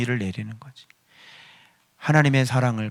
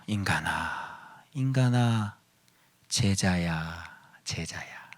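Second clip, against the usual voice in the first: very slow, hushed call to disciples; changed refrain; 5 semitones lower, 7 dB quieter, much slower than usual. Shown as a demonstration. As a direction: draw out each word as a tender summons. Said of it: "그 소리 이렇게 바꿔야죠". (아주 느리게 속삭이듯 부르기)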